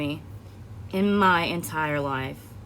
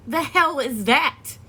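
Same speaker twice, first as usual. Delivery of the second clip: shocked disbelief; 7 semitones higher, much slower than usual. Retold or imitated. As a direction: stretch it out in disbelief. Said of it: "And I was like".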